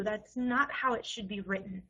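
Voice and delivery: ordinary delivery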